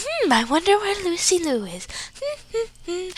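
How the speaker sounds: lightly voiced mom, high and airy wondering; musing mom. Mom wondering where her daughter is, idle musing.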